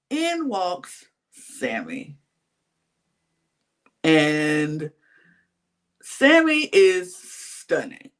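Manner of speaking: slow, drawn-out build-up; dramatic reveal. Real, not acted. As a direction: stretch it out with big pauses for the reveal.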